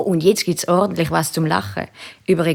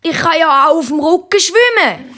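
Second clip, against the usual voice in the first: hohe, protestierende Kinderstimme; wehrt sich; 9 semitones higher, 4 dB louder than usual. A narrator's reading. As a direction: halte hoch dagegen wie ein Kind. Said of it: "wehrt sich Tamara". (hochi Meitlistimm, wehrt sich)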